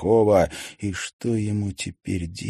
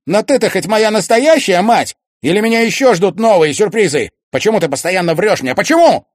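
high, very loud questions, rising to a peak; demanding shout; 13 semitones higher, 12 dB louder than usual. A narrator's reading. Son demanding the truth from his mother, shouting. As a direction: hurl the questions, loudest on the last.

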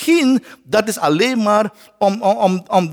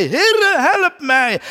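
high pitched call, rising to a cry; desperate cry for help; 9 semitones higher, slower than usual. Acted. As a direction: call out as a sinking man. Peter sinking, calling out. (hoog en wanhopig roepen)

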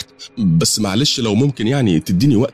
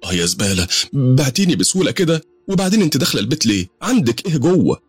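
much higher, rushing angry woman talking to herself; muttering fury; 9 semitones higher than usual. Acted. Dida fuming to herself at Aziza's betrayal. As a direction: seethe at the traitor, to yourself. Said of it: "وديدة بتكلم نفسها".

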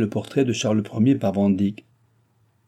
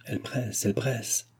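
hushed aside; 8 dB quieter than usual. A narrator's reading. Confiding aside about the baroness.

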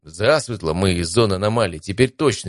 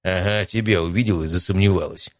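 plain reading voice

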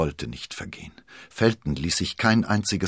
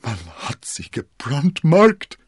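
much higher, louder, slow outcry; shaken shout; 9 semitones higher, 9 dB louder, slower than usual. Acted. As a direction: shout it out in horror. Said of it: "schrie Felton erschüttert".

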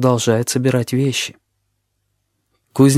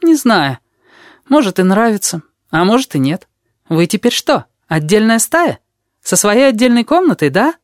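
put-on high, cheeky little boy voice; sly high kid, teasing; odd kid voice; 10 semitones higher, 3 dB louder than usual. A narrator's reading. Вонючка speaking.

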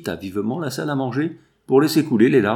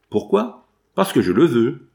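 willful insistence; 4 semitones higher, 6 dB louder than usual. A narrator's reading. Willful daughter insists to her father.

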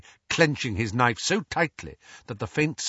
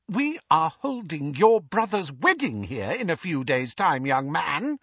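stern mother; 7 semitones higher than usual. Acted. Mrs Weasley scolding.

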